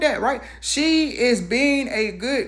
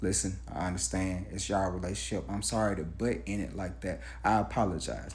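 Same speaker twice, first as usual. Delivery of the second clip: low, soft, sheepish apology; sheepish son apology; 14 semitones lower, 9 dB quieter, faster than usual. Retold or imitated. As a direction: mumble a grudging sorry. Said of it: "He says".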